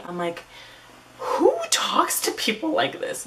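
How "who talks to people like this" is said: very high, drawn-out disbelief; incredulous. Real, not acted. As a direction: squeal the question slowly in disbelief. Appalled at his crude text.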